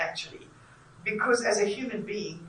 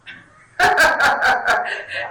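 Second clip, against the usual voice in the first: loud laugh; 5 semitones higher, 11 dB louder than usual. Real, not acted. Host laughs at the sheriff joke, loud and high. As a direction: burst of loud breathy laughter.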